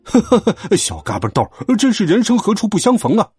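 laughing bully. A narrator's reading. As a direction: open on a mocking belly laugh. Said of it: "哈哈大笑道".

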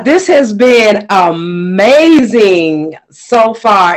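normal talking voice

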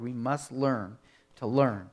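normal talking voice

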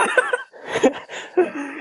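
high breathy sheepish giggle; airy laugh at yourself; embarrassed giggle. Real, not acted. Laughs after being teased.

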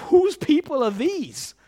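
high, loud mock outrage; indignant angels. Angels coming down to disown the people, high indignant complaint. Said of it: "imagine they said this".

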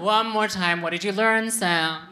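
loud crowd-leading chant; rallying call. Pretend. Comedian getting the audience to ask her, loud rallying call. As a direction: call it out for them to shout back.

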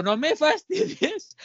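high, slow disbelieving protest; incredulous. Retold or imitated. Retelling his reply to the girl, high incredulous disbelief. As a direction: stretch it out in total disbelief.

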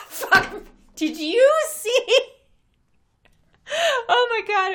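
high and loud excited outburst. Real, not acted. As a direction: burst out with glee. Excited about a funny tweet.

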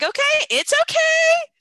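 very high, loud it's-all-fine voice; retold over-bright reassurance. Mom after kid spilled carrot seeds, high-pitched mock reassurance.

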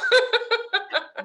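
squealing giggle; high falling giggle; high laugh. Real, not acted. Laughing at own joke.

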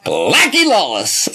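slow, drawn-out name drop; mock-dramatic impression of a brother; El Sancho. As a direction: draw the name out like a boastful showman. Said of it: "that's how he would say it".

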